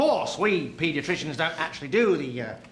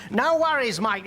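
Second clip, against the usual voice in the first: put-on Australian accent; 5 semitones higher, slower than usual. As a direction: fake a broad Australian accent on the phone. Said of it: "Oh, he's Australian".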